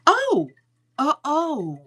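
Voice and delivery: high, loud yelp of realization; sudden surprised oh. Real, not acted. Storyteller cutting herself off in sudden realization, surprised. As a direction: it suddenly clicks.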